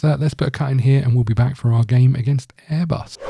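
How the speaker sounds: normal talking voice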